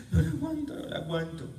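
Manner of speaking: high, tearful wail, then fading; imitating a crying woman. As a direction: mimic the upset mother's sob. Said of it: "La señora llega llorando". (imitando a una mujer llorando)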